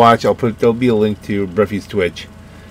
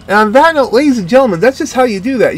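excited sign-off; 12 semitones higher, 5 dB louder than usual. Real, not acted. Sign-off to viewers.

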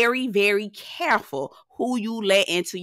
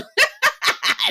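squeaky rising laugh; high giggle. Real, not acted.

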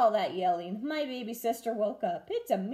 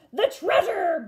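higher, louder booming chorus; booming crew; 9 semitones higher, 8 dB louder than usual. A narrator's reading. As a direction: boom it in alarm. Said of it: "boomed the crew".

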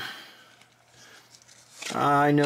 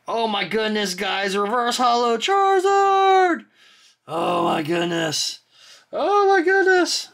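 high, loud thrilled cry; squealing over a Charizard pull; excited shout; 8 semitones higher, 4 dB louder than usual. Real, not acted. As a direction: shout it out in delight. Pulls reverse holo Charizard.

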